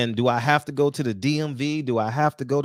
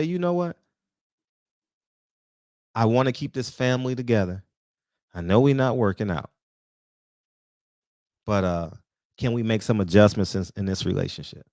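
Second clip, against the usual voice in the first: lower, gentle voice of a man reasoning with his partner; calm sit-down talk; 7 semitones lower than usual. Pretend. A man sitting his woman down to keep the family together, calm and lower.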